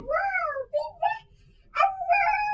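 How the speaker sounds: high, loud delighted wow; excited. Acted. A sister hears about pizza, cries out delighted. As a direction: gasp with greedy delight.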